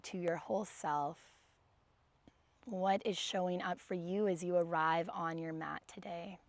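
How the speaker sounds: gentle guided meditation read. Acted. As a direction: ease the class into stillness. Leads seated breathing meditation.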